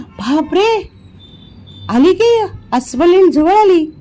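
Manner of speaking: shouting a warning. A narrator's reading. Vihari warns Vijay to flee the bear: urgent high shout. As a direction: shout to a friend to run and climb. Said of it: "Vihari ओरडला".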